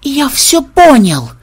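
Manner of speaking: high, slow, loud revelation; drawn-out excited cry; yelling. A narrator's reading. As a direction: stretch it out in a eureka yell. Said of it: "завопил ксенобайт".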